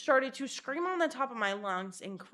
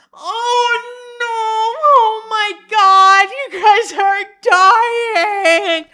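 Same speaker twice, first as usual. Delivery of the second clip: high, wailing mock panic scream; hammed-up freak-out, very loud and drawn out; hysterical mom; 13 semitones higher, 19 dB louder, much slower than usual. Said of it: "Tweaking off her shit".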